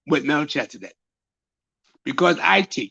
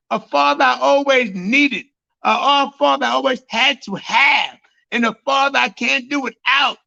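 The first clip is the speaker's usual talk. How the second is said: higher pitched sermon cry building to a shout; fervent preaching; 4 semitones higher than usual. Acted. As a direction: swell it up into a preacher's cry for the father.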